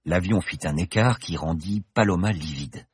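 usual speaking voice

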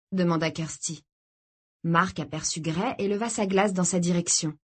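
ordinary delivery

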